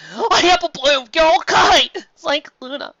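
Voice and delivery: high, loud relieved cry; Applejack's high, loud relief. A narrator's reading. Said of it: "she raced over".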